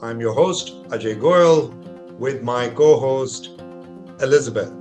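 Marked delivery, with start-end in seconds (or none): none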